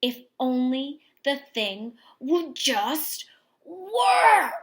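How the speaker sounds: loud, slow, strained frustration with a laugh; loud, slowed cry of frustration; frustrated outburst. Acted. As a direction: burst out in exasperation. Frustrated girl's wish voiced.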